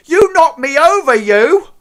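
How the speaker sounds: very high, loud indignant cry; outraged shriek. Acted. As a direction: yell at whoever knocked you down. Crank knocked down, outraged.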